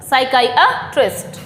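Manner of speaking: "साइकियाट्रिस्ट" as sai-KAI-uh-trist, slow; "साइकियाट्रिस्ट" with a long kai, then uh-trist; correct pronunciation. Shown as a demonstration. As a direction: model "साइकियाट्रिस्ट" slowly, syllable by syllable. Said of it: "कैसे बोला जाएगा पता ही नहीं".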